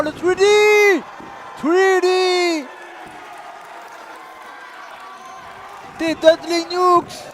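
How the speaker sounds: high drawn-out move call; excited shout. Real, not acted. Calling signature move. (annonce criée de la prise)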